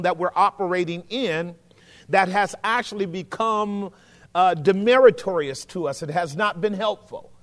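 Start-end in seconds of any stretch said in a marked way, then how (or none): none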